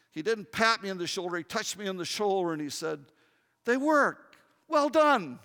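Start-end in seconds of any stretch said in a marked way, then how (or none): 3.6-5.4 dad's praise. Retold or imitated. Dad praising the son.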